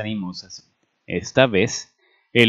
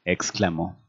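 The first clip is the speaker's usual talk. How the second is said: ordinary delivery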